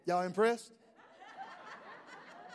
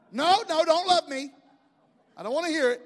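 mock protest; 7 semitones higher, 6 dB louder than usual. Comic protest against tough love.

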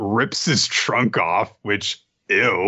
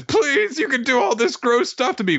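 high pleading voice. Retold or imitated. Retelling Dosan's plea.

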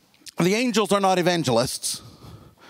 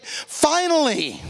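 relieved finally; 5 semitones higher, much slower than usual. Pretend. Oppressed faithful hear judgment.

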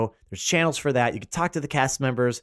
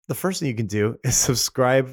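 usual speaking voice

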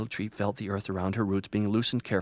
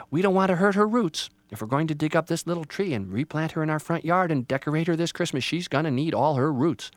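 slightly raised, firm voice; concerned mother; 5 semitones higher, 6 dB louder than usual. Acted. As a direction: caution him kindly but firmly. Mother cautions.

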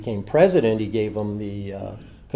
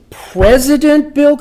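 much higher, louder and slow, stunned question; dumbfounded echo; 12 dB louder, slower than usual. Retold or imitated. Author hearing Jackie name the president, high stunned disbelief. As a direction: play yourself as slow on the uptake, gasping the name. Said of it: "I went over this three times".

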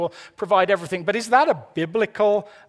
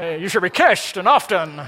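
loud, badly faked American accent with slurred s; hammed-up American gangster-film accent; botched American impression, "kissed" slurred to "kisht"; James Cagney; 5 dB louder than usual. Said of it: "always sounds like James Cagney".

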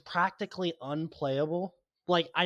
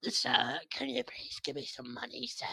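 high, soft pleading beggar voice; blind beggar; 8 semitones higher, 6 dB quieter than usual. Acted. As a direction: beg in a small, high put-on voice. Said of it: "a blind beggar on the road".

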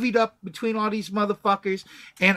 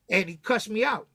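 normal talking voice

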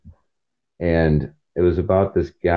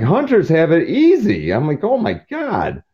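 amazed outburst; 10 semitones higher than usual. Retold or imitated. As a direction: exclaim in amazement.

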